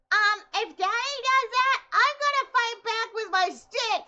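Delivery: put-on high, slow childish threat; imitating little sisters. Sisters threatening their dad, high kid voice. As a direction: play it as a defiant kid. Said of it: "they're like".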